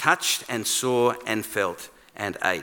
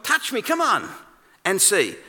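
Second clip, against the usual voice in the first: inviting, coaxing; 7 semitones higher than usual. Acted. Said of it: "He's invi".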